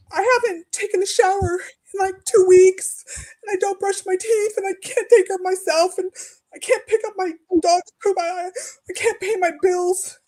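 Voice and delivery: high, crying pleading; tearful plea. Retold or imitated. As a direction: plead while breaking into tears. Retelling begging the crisis line.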